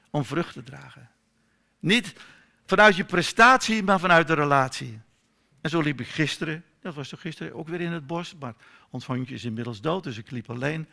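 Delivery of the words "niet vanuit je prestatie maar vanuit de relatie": loud, higher, hammered-home contrast; emphatic preaching. Real, not acted. Preacher declaring to his congregation, loud and emphatic. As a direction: drive the point home to the room. Preacher stressing grace over performance.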